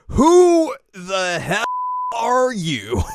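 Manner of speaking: high, loud, drawn-out outrage; indignant challenge. Retold or imitated. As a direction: stretch each word in mock outrage. Acted indignant confrontation.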